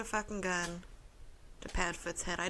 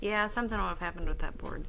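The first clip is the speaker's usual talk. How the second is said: ordinary talk, nothing marked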